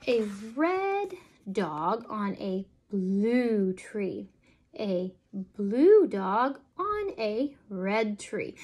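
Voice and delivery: slow, lilting picture-book read; reading to children. Acted. Parent reading a counting book to a toddler, slow and sing-song. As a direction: draw the little listener into each page.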